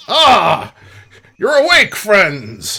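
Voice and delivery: loud, high-pitched hail to friends; delighted greeting. Acted. Rogue greets waking adventurers, delighted. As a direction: call out in glad welcome.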